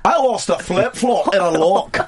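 slow, hammed-up Scottish accent; drawn-out Scottish brogue, played up. Shown as a demonstration. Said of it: "Are we all just doing that now".

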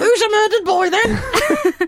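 high, slow squawky parrot talk. Acted. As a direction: coo it like a pet parrot, laughing.

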